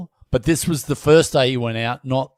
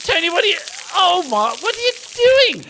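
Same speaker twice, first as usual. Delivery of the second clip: horrified mock outrage; faster than usual. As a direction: cry out appalled at the gardener. Gardener urinating on Newton.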